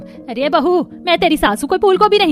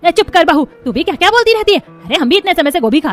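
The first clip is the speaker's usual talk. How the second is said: loud, raised-pitch telling-off; irritated mother-in-law rebuke; sharp scolding; 7 semitones higher, 6 dB louder than usual. Acted. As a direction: cut her off crossly. Mother-in-law silences daughter-in-law.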